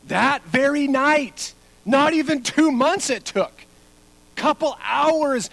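loud, high, slow emphatic build; emphatic preaching. Acted. Pastor stressing how fast Peter fell, slow loud emphasis. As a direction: lean hard on each phrase.

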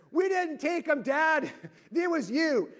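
high, loud protest, imitated; daughters yelling down. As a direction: imitate kids hollering back at dad. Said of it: "my daughters yell down from upstairs".

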